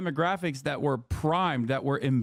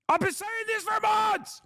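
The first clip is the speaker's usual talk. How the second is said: exasperated shout. Real, not acted. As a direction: yell it, fed up. Exasperated outburst.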